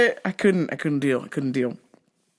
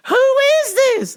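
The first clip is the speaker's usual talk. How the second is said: put-on old lady caller; 7 dB louder than usual. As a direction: quaver it out like a frail old lady.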